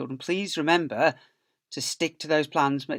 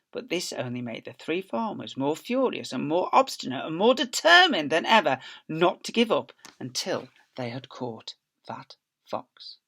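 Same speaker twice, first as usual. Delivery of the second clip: usual speaking voice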